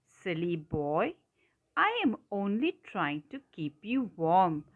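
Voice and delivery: ordinary talk, nothing marked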